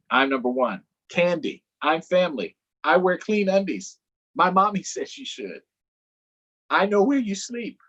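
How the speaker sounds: high, eager cartoon donkey; perky donkey pitching for votes. Donkey asking kids for votes, high and eager.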